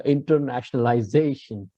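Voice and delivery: internationalization with the accent on "za"; stress on second-last syllable. Shown as a demonstration. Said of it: "put stress on the penultimate".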